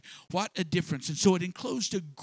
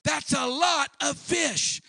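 higher, slower, louder on lot; emphatic exclamation; 9 semitones higher, 4 dB louder, slower than usual. Acted. Preacher marveling at the catch, emphatic exclamation. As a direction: stretch it out in amazement.

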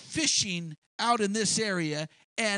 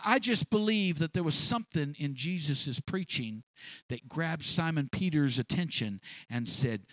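normal talking voice

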